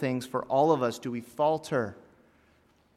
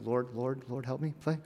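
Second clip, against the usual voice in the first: hushed, mock-panicked plea; quietly begging for help; nervous prayer; 10 dB quieter than usual. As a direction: mutter a frightened little prayer. Acting nervous prayer while driving.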